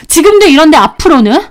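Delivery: fed-up wife; indignant challenge. Acted. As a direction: throw the question back at him. Wife argues with husband.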